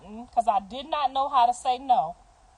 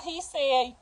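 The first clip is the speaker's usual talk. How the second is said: ordinary delivery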